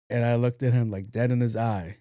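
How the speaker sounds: ordinary talk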